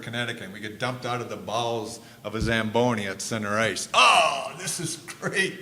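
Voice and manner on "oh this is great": high, loud burst of delight; loud, higher exclamation of delight; thrilled. Retold or imitated. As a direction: gush with joy at the moment. Thrilled arriving at center ice.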